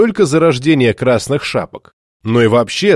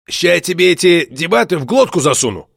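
emboldened threat; 4 semitones higher than usual. Acted. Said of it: "пообещал осмелевший гнилич".

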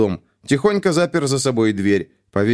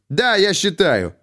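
heated exclamation, pitched higher; 9 semitones higher, slower than usual. A narrator's reading. Captain defying the colonel, heated. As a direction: flare up in defiance. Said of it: "запальчиво воскликнул капитан".

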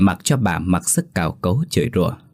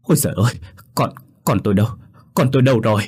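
frantic shriek; 3 semitones higher, much faster than usual. A narrator's reading. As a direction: shriek it out like a mother gone mad with fear. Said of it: "Rồi bà rú lên, điên dại".

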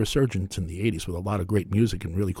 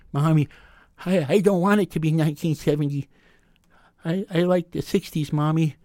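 higher, a lightly voiced crying child pleading with mommy; whiny little kid; 7 semitones higher than usual. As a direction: whimper like a small boy who doesn't want the year to change. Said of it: "and I cried my little eyes out".

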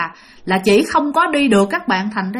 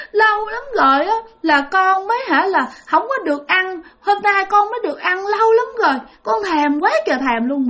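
put-on higher, tearful little-kid voice; crying child's voice; 8 semitones higher than usual. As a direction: mimic a child sobbing while eating. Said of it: "vừa ăn vừa khóc vừa nói". (giọng con nít vừa ăn vừa khóc)

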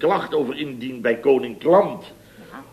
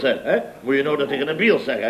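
ordinary talk, nothing marked

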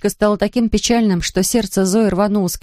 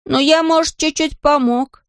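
put-on high-pitched child's voice; high, drawn-out childlike admission; modest little boy; 9 semitones higher than usual. A narrator's reading. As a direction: admit it coyly like a small boy. Boy Patrick admits helping.

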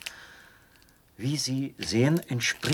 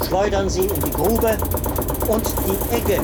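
loud and much higher, straining over the machine's noise; shouting over machinery; 8 semitones higher, 7 dB louder than usual. Acted. Officer proudly explains the running machine to the traveler, shouting over the noise. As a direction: shout every explanation over the roaring apparatus.

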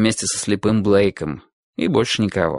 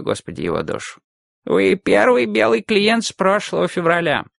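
put-on old man voice; 9 semitones higher than usual. Old barber voiced by narrator.

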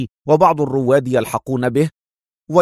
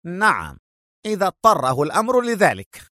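mildly angry retort, pitch raised; 6 semitones higher than usual. A narrator's reading. Rival retorting to Adham's mockery, mildly angry. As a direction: answer back with understated anger. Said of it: "أجابه لانسلوت في غضب".

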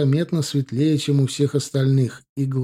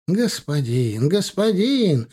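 slow, rising plea with the second word much higher; pleading merchant; 5 semitones higher, much slower than usual. Acted. Caravan man pleads with the officer, slow rising plea. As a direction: beg the officer, drawing it out.

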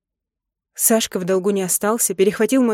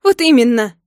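loud, higher-pitched exclamation; angry retort; 4 semitones higher, 8 dB louder than usual. A narrator's reading. Wife snatching soap from husband, angry retort. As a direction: snap back at him.

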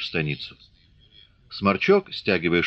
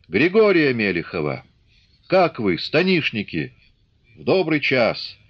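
higher, hearty call to the crowd; officer calling out; 5 semitones higher than usual. Acted. Officer calls Grigory out before the assembly, hearty and raised. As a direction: summon him out of the crowd with a grin.

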